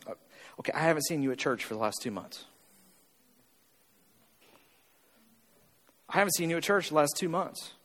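low, quiet deadpan remark; pastor's inner thought. Retold or imitated. Pastor privately noting a churchgoer's absence, low and soft. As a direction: say it softly, like an unspoken thought. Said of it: "in the back of my head, I'm going".

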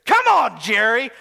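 loud, pleading yell at the TV; calling out to the team owner; shouting at Jerry. Retold or imitated. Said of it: "he talks to Jerry Jones".

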